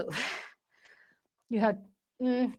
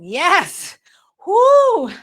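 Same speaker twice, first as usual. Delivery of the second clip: loud high cheering whoop; excited whoop; 7 semitones higher, 10 dB louder than usual. Real, not acted. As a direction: whoop them on. Cheering during workout.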